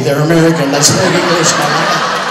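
loud, forceful correction; exasperated wife. Retold or imitated. Wife correcting husband who misread the sign, exasperated. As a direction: set him straight at full volume.

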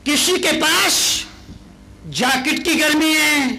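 rousing preacher; preaching at full force. Acted. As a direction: build each line to the punchline. Preacher builds to punchline.

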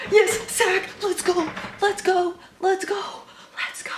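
high-pitched squealed cheers; excited sack celebration. Real, not acted. Fan cheering a sack late in the game, excited squeals. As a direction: cheer in short high bursts without extra volume.

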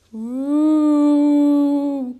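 long, high, very loud "wooo". A narrator's reading. Moan comes much closer to the friends, loud ghostly wail. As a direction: swell the ghostly wail as if closing in. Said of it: "The moan sounded much closer".

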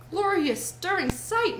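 high, voiced entranced wonder; rapt murmur. A narrator's reading. Toad raves about the motor car, rapt wonder. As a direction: marvel aloud in a trance. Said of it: "murmured Toad".

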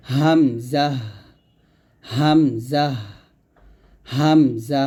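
হামজা drawn out for learners to repeat; correct Hamza pronunciation. Shown as a demonstration. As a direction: model হামজা slowly, both syllables long and even. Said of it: "সঠিক উচ্চারণটা আমার সাথে করেন".

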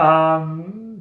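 ordinary delivery

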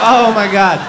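loud, laughing oh my God; laughing exclamation. Real, not acted. Comic reeling from the toddler's excuse, loud laughing shout. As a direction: blurt it out while still laughing.

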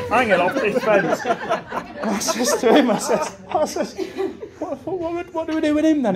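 high laughing question; barely getting words out for laughing. Real, not acted. Retelling the fence mishap, laughing hard.

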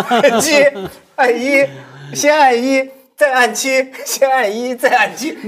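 high, loud, short separate phrases; animated button-pressing. Retold or imitated. Guest mimics the masseuse pressing lift buttons, high and emphatic.

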